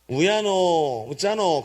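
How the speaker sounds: lamenting phrase with Gyeongsang lilt; Gyeongsang dialect. Shown as a demonstration. Preacher translates "what shall I do", in Gyeongsang dialect. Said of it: "경상도 사투리로".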